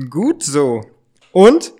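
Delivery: ordinary talk, nothing marked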